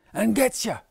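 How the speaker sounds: high, loud scary shriek. Acted. Arthur spooking the other kids with a shriek. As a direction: jump at them with a shriek. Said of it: "Arthur shrieked".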